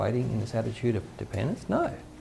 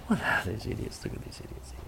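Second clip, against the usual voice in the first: hushed scornful aside from the crowd; mocking onlookers; 3 semitones lower, 8 dB quieter than usual. Preacher voicing the Canaanites mocking Israel, hushed and scornful. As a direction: sneer at the marching army under your breath.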